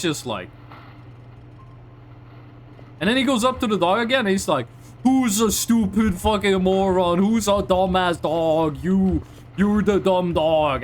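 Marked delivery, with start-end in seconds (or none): 5.0-10.8 insults cooed as praise, drawn out; slow, mock-affectionate dog talk; doting dog-owner voice. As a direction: imitate an owner gushing slowly over a dog. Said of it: "They only care about how you say it".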